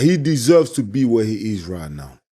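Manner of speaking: normal talking voice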